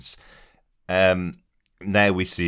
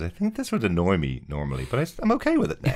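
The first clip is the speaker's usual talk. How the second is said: high, fast mock-calm Kevin Bacon voice, laughing; imitating Kevin Bacon; 4 semitones higher, faster than usual. Podcaster imitates Kevin Bacon accepting Mantis's advice, mock-calm and laughing. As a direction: play him suddenly serene and amused. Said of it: "and he goes".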